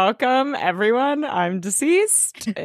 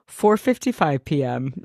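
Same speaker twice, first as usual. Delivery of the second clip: low, deadpan time stamp; dropped-pitch mock announcement; editors' time caption; 7 semitones lower than usual. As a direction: read it like an on-screen caption. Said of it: "I love the editors go".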